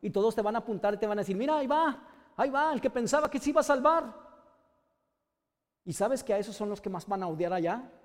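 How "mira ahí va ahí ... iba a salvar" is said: higher pitched jeer, pointing at the fallen one; jeering mockery. Crowd jeering at the fallen brother, mocking higher voice. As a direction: sneer it out like a crowd pointing and laughing.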